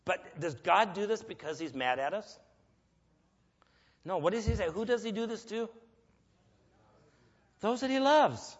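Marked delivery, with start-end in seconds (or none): 4.1-8.6 rising preacher's answer. Real, not acted. Preacher asks and answers congregation.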